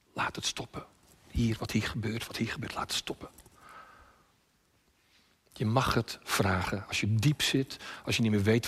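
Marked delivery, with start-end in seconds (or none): 0.1-3.3 soft, near-whispered plea; hushed prayer. Retold or imitated. Preacher praying beside suicidal girl, hushed. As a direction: murmur it softly to God, voice dropping to breath. Retells bedside prayer on psychiatric ward.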